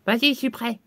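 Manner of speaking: ordinary talk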